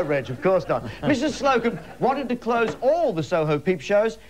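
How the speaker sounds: normal talking voice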